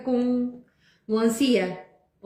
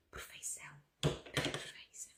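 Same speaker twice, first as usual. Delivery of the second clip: unvoiced, slowed whisper of admiration; 18 dB quieter, slower than usual. Real, not acted. Host admiring the pancake, whispered perfeição. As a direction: breathe the word out in awe.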